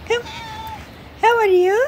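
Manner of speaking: high cooing pet voice. Real, not acted. Greeting the cat, high cooing baby talk.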